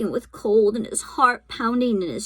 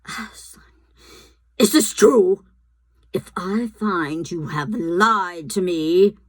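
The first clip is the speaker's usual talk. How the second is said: threatening queen; 5 dB louder than usual. A narrator's reading. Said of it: "cried the queen".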